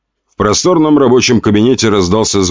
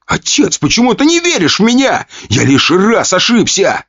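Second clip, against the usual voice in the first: shouted plea; 12 semitones higher than usual. Acted. Son shouts at his father, begging to be trusted, desperate high shout. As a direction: hurl the plea at your father. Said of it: "прокричал парень".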